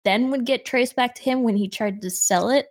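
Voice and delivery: ordinary talk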